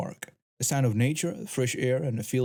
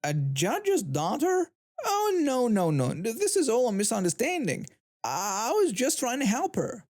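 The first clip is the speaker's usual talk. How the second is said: panicked; 9 semitones higher than usual. A narrator's reading. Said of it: "I heard the woman gasp".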